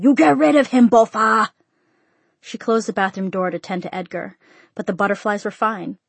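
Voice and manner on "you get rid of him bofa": loud, higher-pitched shouted order; angry father yelling; father's shout. A narrator's reading. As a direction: shout the command across the house at your daughter. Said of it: "her father shouted".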